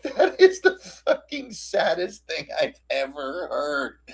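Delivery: slow, squeaky-voiced heartbreak with a laugh; half-laughing, overcome with sympathy; mock-tearful pity. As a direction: ham it up as if about to cry. Party overcome by troll's story.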